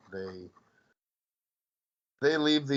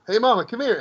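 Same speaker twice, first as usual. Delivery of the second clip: much higher, mock-friendly call; Craig's dad; 12 semitones higher, 4 dB louder, faster than usual. Dad beckoning mama before the smack, imitated high-pitched call. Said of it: "Craig's dad literally goes".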